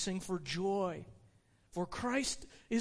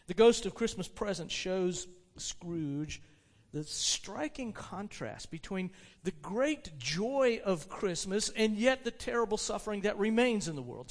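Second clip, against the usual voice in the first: ordinary delivery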